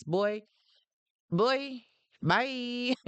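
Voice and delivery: a sassy, drawn-out brush-off. Real, not acted. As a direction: wave him away with attitude. Dismissing the ex.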